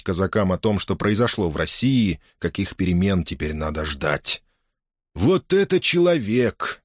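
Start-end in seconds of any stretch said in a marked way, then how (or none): 5.1-6.8 high, slow admiring exclaim; sincere admiration. Acted. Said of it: "искренне восхитился вслух Никула".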